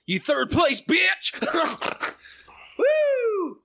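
high, gleeful trash talk; excited taunt. Real, not acted. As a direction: gloat at the loser. Taunting loser of drinking race.

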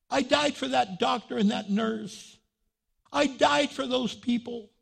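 impassioned preacher voicing God; God's declaration. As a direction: declare it high and strong, with conviction. Voicing God's emphatic answer.

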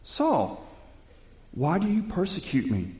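a high, calling heavenly voice; a lightly voiced Jesus calling out to Saul. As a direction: call his name down from above. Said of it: "heard a voice say to him".

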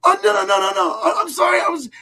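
high, loud, panicked mock apology; flustered apology. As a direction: imitate her squealing oh no in embarrassment. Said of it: "she was like".

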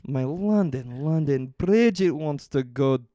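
slow, drawn-out mock Scottish lyric; Scottish accent. Taking the Scottish lyric dare, slow mock Scottish. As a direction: stretch each word in a hammed-up Scottish brogue. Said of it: "in a Scottish accent".

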